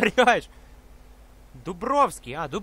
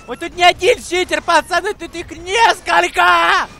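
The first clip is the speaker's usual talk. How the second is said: excited shout; 6 semitones higher, 7 dB louder than usual. Real, not acted. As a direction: shout it to the guys. Alarmed announcing to viewers.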